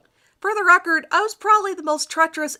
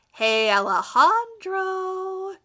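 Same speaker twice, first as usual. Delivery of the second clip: slow, higher, lightly voiced flirty redhead; 7 semitones higher, 3 dB louder, much slower than usual. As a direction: draw out his name to flirt.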